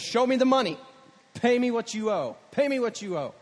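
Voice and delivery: hammed-up bully shaking down a debtor; mock angry demand. Servant throttling his debtor, mock angry shout.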